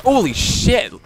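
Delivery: loud, high, slow exclamation; stretched-out cry of amazement; startled shout. Real, not acted. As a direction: blurt it out in shock. Startled by event in game.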